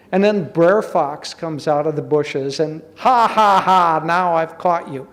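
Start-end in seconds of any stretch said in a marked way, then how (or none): 3.0-5.1 gloating fox. Acted. Fox springs from the bushes, gloating with mock laughter. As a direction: crow over your catch, easing off.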